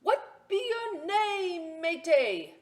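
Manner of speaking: slow, loud, high pirate voice; a gruff captain sizing up the boy; pirate asking. Acted. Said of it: "the head pirate asked".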